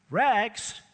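high, loud, slow hawker's call; the sobbing ragman cries his trade aloud; crying out. A narrator's reading. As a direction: call it out down the street while grieving. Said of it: "cried the sobbing".

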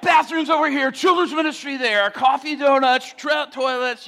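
high, loud shouting, item after item; frantic announcements. Acted. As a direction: call out announcements in comic nervous frenzy. Acts frantic announcements while dodging.

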